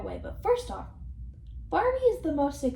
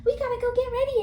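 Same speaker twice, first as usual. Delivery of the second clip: higher and louder, eager rush; mock excited Barbie; 12 semitones higher, 4 dB louder, faster than usual. Vlogger imitating Barbie urging everyone to get ready, excited squeal. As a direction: squeal the urgency. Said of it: "And then she's like".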